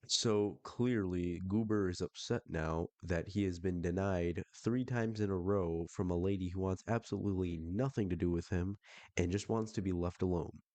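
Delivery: ordinary delivery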